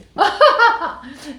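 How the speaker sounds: loud, high squeal of a laugh; excited laugh. Pretend. Mary squealing with delight to a friend, loud laugh.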